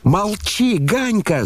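very high-pitched outcry; boyish shout. A narrator's reading. Kolya silences his brother, shouting. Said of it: "закричал Коля".

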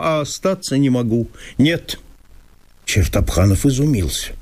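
ordinary delivery, nothing marked

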